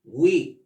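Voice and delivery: "We" as a clear "wee"; pronunciation model. Shown as a demonstration. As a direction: model "We" on its own for learners. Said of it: "¿Y cómo se pronuncia?".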